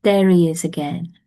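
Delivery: ordinary delivery, nothing marked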